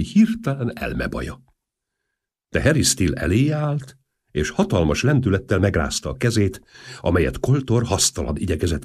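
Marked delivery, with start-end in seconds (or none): none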